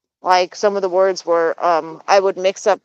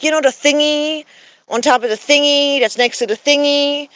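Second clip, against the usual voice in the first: playing herself as clueless to her husband; ditzy self-parody; 6 semitones higher than usual. Asking her husband about the thingy, high and silly. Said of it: "I'll be like".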